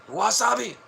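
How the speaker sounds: very high, loud quick "Wasabi!"; mock Japanese shout. Mocking Japanese speakers, short high shriek. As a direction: squeal "Wasabi!" sharply. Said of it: "You don't hear Americans talking like that".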